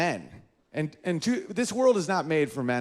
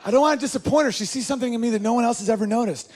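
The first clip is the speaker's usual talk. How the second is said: put-on eager flattered boyfriend; 7 semitones higher, faster than usual. Flattered man eager to please.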